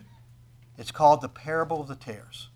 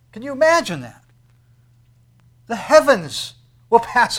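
loud, soaring pulpit delivery with stretched climaxes; full-force preaching; 8 semitones higher, 15 dB louder than usual. Acted. Preacher to congregation about the last day, soaring loud preaching. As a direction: thunder it out to the whole room.

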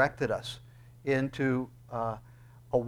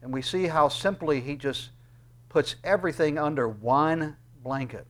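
normal talking voice

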